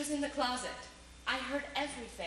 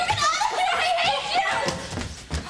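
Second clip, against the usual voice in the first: much higher and much louder, screaming with a break in the voice; furious scream; 10 dB louder, slower than usual. Acted. Wife screaming at her cheating husband, furious. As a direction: scream it at him with all you have.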